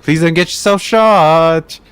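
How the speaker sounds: slow lilting high sing-song; sing-songy. Retold or imitated. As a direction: stretch it out like a little tune. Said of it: "in a more sing-songy voice".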